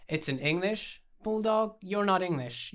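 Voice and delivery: animated, argumentative objection; incredulous challenge. A narrator's reading. Mocking argument over the dog.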